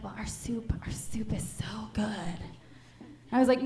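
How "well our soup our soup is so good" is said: slow, soft whispered sales pitch; breathy LA cashier. Retold or imitated. LA cashier whispering her soup recommendation, softly. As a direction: sell the soup in a hushed whisper. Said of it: "She's like".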